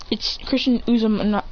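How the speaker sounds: ordinary delivery, nothing marked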